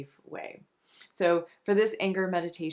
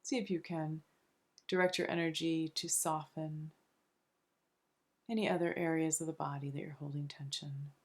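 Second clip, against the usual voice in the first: soft, soothing meditation voice with long pauses between phrases; unhurried, hushed relaxation guide; guided meditation; 2 semitones lower, 4 dB quieter than usual. Acted. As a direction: gently guide them into calm, phrase by phrase.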